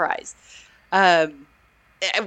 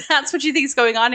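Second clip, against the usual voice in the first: incredulous question; 7 semitones higher, faster than usual. Retold or imitated. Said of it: "I love her intonation on that line".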